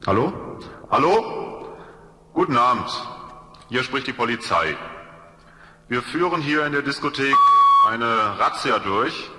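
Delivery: usual speaking voice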